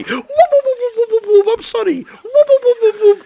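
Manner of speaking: very high, loud whooping stooge; rapid high-pitched slapstick whoops; Curly. Acted. Co-host picturing inmate running in circles, zany Stooge whooping. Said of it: "Like Curly".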